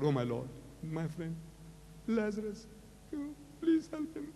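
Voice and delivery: pleading, voice rising into a cry; quiet crying plea to God; tearful prayer. Retold or imitated. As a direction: beg God for your friend through tears. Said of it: "He's crying and the sobbing".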